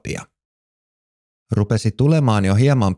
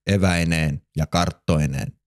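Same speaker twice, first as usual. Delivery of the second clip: ordinary delivery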